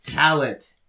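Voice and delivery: ordinary talk, nothing marked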